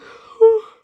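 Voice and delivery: loud, long open-mouthed yawn; big yawn. Real, not acted. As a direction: let out a real, noisy yawn. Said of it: "Now I'm yawning".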